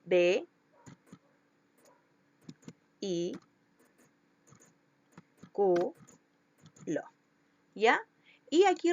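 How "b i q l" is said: "B, I, Q, L" as isolated syllables with long pauses. Shown as a demonstration. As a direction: sound out "B, I, Q, L" one at a time. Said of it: "las separo y me quedaría". ("B, I, Q, L" sílaba por sílaba, con pausas)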